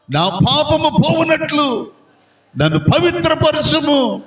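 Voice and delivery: high, fervent pleading psalm prayer; King David begging God to wash away his sin. Acted. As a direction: cry out to God for mercy, pitched high. Voicing David's penitent psalm prayer.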